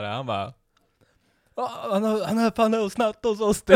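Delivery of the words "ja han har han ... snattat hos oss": high, loud mock outburst; hammed-up indignant cry, pitched way up; agitated accusation. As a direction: imitate a worked-up accuser.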